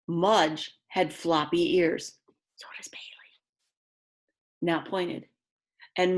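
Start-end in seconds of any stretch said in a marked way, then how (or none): 2.6-3.3 breathless whispered side remark; whispered aside. Real, not acted. Aside about her dog.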